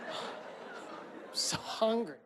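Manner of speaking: breathy, slow, voiceless plea; drawn-out hungry whisper; whispered whine. Acted. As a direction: whisper it like a starving kid. Whining stoned kid.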